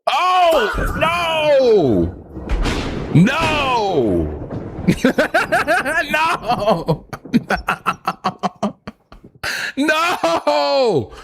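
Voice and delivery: high, hammed-up wails of grief; mock despair. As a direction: wail in melodramatic disbelief. Mock grief over lost AI voice.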